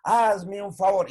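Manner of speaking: slow, drawn-out flattering greeting; butter up mamita, stretching every word; loud, slow, pleading flattery; syrupy sweet-talk to mom. Retold or imitated. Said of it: "Imagínense que uno hablara, yo todos los días saludara".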